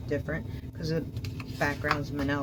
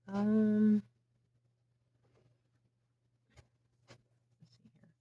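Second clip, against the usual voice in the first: talking through a yawn; words stretched by a yawn; 6 semitones higher, 4 dB louder than usual. Real, not acted. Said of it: "Sorry for the yawn".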